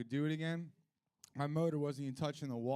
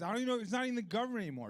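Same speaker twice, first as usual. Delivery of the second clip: rapid rant; 9 semitones higher, 4 dB louder, much faster than usual. Real, not acted. Fast rant.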